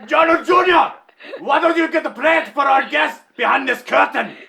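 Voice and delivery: high, loud, laughing call to the son; mock shouted order. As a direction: bellow the order while cracking up.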